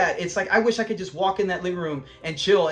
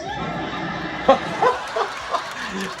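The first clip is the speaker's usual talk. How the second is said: real laugh; 5 semitones higher than usual. Real, not acted. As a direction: crack up at the joke. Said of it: "laughing at the clip".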